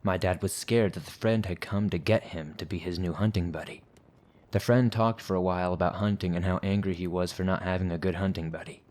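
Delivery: usual speaking voice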